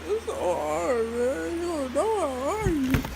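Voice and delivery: complaining to viewers about his car; whiny lament. Real, not acted. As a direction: moan it like life is unfair.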